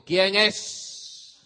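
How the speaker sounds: loud booming father's challenge; father at the door, booming; thunderous voice. Retold or imitated. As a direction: boom it out like thunder. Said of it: "con una voz de trueno".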